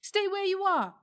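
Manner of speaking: high, loud warning cry; boy shouting a warning at the witch, loud. A narrator's reading. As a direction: stop her in her tracks. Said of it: "cried Diggory".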